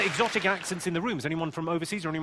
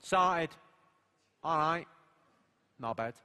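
lightly done Mancunian; Manchester accent; 5 dB quieter, slower than usual. Shown as a demonstration. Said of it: "the accent's pretty easy for Manchester".

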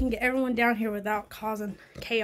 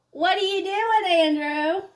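calling out; 8 semitones higher, 5 dB louder than usual. Real, not acted. Calling out to husband outside.

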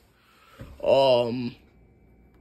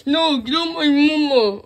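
crying out; 8 semitones higher, 7 dB louder than usual. Pretend. Child panics as mother attacked.

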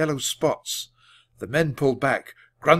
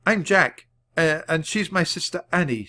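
nervous; 6 semitones higher, 3 dB louder than usual. A narrator's reading. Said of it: "tried to keep his voice steady".

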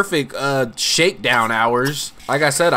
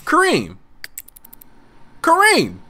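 high, drawn calls of Kareem; sing-song call to Kareem; 13 semitones higher than usual. Acted. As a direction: call him across the house. Calling his Sim by name.

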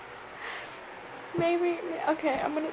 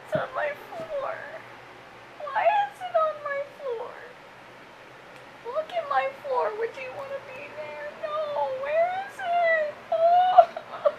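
frightened whimper; 8 semitones higher than usual. Real, not acted. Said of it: "I'm frightened".

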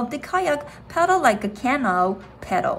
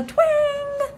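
twang sound effect. A narrator's reading. Slingshot snapping.

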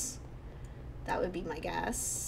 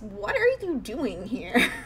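ordinary delivery

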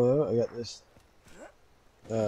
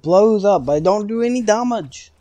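higher, louder rising exclamation; exclaiming; 8 semitones higher, 9 dB louder than usual. Real, not acted. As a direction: voice climbs high and loud. Mock complaint about the gun.

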